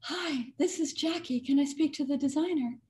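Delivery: high, breathy society lady voice; lightly voiced elegant caller; Jackie Kennedy. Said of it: "who said".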